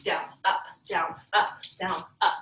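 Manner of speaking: rhythmic rep calls, punchy; aerobics instructor. Acted. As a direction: call the reps like a fitness coach. Instructor calling reps in rhythm.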